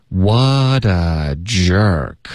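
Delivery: drawn-out, linked model sentence; slow linking demo. Shown as a demonstration. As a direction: say it very slowly for learners. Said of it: "我们请老师来先示范一下". (慢速连读示范)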